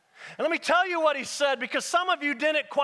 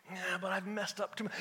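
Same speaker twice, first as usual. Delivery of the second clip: soft, low objection; doubter's thought; 4 semitones lower, 11 dB quieter than usual. Acted. Preacher voicing a listener's objection, quiet and low. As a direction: mumble the doubt under the preaching. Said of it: "I can hear your thoughts".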